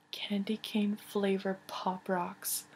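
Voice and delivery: slow excited whisper; hushed slow reveal. Real, not acted. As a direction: breathe it out like a secret treat. Showing candy in package.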